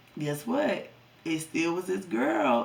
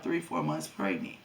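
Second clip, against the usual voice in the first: ordinary delivery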